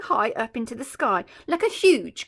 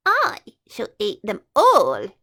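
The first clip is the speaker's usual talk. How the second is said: a hint of a crocodile, louder and slow; lightly voiced greedy crocodile; 7 dB louder than usual. Crocodile plotting to eat the children, lightly voiced greedy gloat. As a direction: gloat slowly over the feast.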